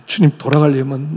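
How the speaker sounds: normal talking voice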